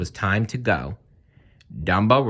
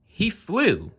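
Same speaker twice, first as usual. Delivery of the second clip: high, smug answer; high crow voice; 11 semitones higher than usual. Acted.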